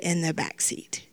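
breathy confiding whisper; whispered aside. Real, not acted. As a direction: drop to a hushed whisper mid-sermon. Sermon aside, voice drops to whisper.

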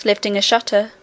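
plain reading voice

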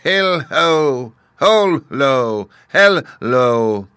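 loud, high spell-casting voice; witch's incantation. A narrator's reading. Witch casting on her right foot, loudly chanted. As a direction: call out each magic word, starting high and stepping down.

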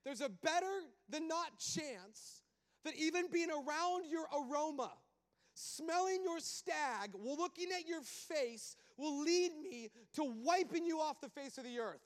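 high, loud and slow, drawn-out God voice; God telling Moses why he will not go; God explaining. Acted. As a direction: stretch out each threat, God warning. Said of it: "how come you're not going".

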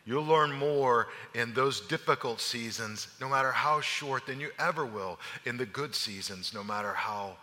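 low, soft sermon voice drawing the lesson; hushed, earnest preaching; reflective preaching. Acted. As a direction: bring it home gently to the congregation. Preaching application, soft and slow.

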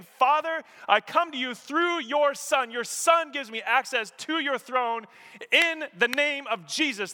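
high, loud preacher's appeal; impassioned praying at full force, every phrase driven; fervent prayer. Acted. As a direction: cry out boldly to God. Acts out an earnest, fervent prayer.

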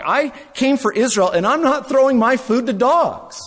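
firm, loud voice of refusal; stern refusal. Acted. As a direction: refuse her flatly, voicing Jesus. Preacher voices Jesus' refusal.

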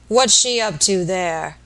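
loud, slow rustic sick man; savage invalid, suspicious. Acted. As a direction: demand it loudly, dragging the words. Sick man's character voice.

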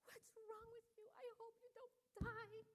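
high, slow, frantic mother's cry; panicky overwhelmed mom. Retold or imitated. Overwhelmed mom frets over her crying baby, high and frantic. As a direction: play the mother who can't cope. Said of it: "grabs her child, and says".

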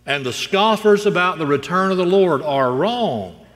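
loud, high, emphatic pulpit warning; forceful preacher's declaration; full-force preaching. Real, not acted. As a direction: proclaim judgment to the congregation. Preacher drives home the warning.

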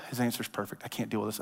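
soft frustrated sigh of defeat; exasperated mutter. Retold or imitated. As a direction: mutter it under your breath in frustration. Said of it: "then they go".

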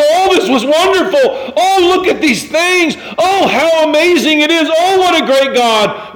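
high, loud, gushing praise; mock delight. Preacher voices what the priests should have said, gushing. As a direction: gush over the wonders as pretend priests. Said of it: "They didn't go".

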